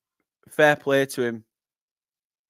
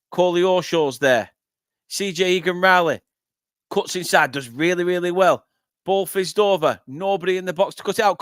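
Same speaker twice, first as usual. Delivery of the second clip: lively, louder football commentary; live play-by-play; 4 semitones higher, 4 dB louder than usual. Real, not acted. Match watcher following Burnley's attack live, lively running commentary. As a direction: call the attack as it quickens.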